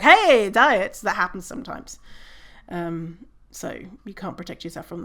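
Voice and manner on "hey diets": loud, chirpy mock call-out; mock passerby. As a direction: toss it off like a stranger heckling. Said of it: "a random passerby being like".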